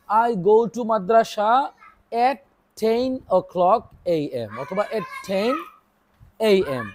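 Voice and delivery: drawn-out model sentence, very slow; slow dictation. Shown as a demonstration. Teacher modelling an answer for young pupils, very slow. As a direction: say it slowly enough for kids to repeat. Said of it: "তাহলে আমি কি বলবো?".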